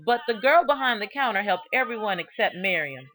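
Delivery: plain reading voice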